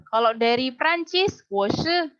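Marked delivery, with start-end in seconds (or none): none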